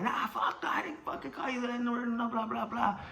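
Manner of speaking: high laughing no way; high laughing protest; laughing denial. Retold or imitated. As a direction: laugh off the accusation.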